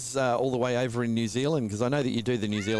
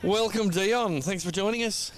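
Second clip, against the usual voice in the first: high put-on Kiwi accent; lightly voiced Kiwi welcome; 10 semitones higher, slower than usual. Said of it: "check this out".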